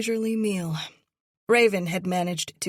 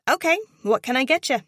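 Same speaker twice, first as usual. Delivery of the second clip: chirpy waitress; 8 semitones higher, 8 dB louder, much faster than usual. A narrator's reading. Waitress takes their order.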